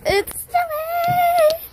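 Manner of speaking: very high, stretched-out cry of delight; excited squeal. Real, not acted. Vlogger out in the first snow, high excited squeal. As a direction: squeal it, holding the last word high.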